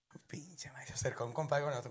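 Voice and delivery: unvoiced, hushed retelling; whisper. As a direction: whisper the embarrassing part. Cuenta encuentro vergonzoso, susurrando. (susurro avergonzado)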